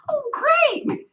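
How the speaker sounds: very high, mock-delighted "Oh, great"; sarcastic. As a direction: drip fake enthusiasm. Sarcastic inner reaction.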